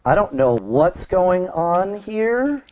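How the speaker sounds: usual speaking voice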